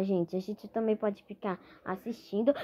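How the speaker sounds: ordinary talk, nothing marked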